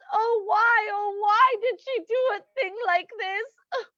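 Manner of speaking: theatrical, weepy grief; anguished, bewildered mourning; tearful lament. Acted. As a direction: wail over a friend's death. Friend laments the aunt's death.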